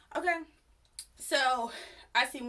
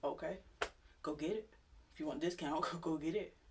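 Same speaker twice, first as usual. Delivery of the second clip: softer, unbothered brush-off; dismissive quiet; 5 semitones lower, 7 dB quieter than usual. Retold or imitated. Cashier coolly telling the customer to fetch his form, soft and dismissive. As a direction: wave him off softly, half laughing. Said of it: "So I'm just like".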